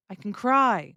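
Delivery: "cry" long and falling, "can" quick; stress on CRY. Shown as a demonstration. Teacher gives another example of ability, stress on "cry". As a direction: land on the verb "cry". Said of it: "put the stress on the verb".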